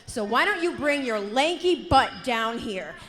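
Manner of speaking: building to a furious shout; angry callout. Acted. Wrestler calls out rival.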